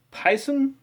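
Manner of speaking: high questioning try at a name; unsure guess. Real, not acted. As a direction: sound it out doubtfully. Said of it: "I'm probably going to butcher these names".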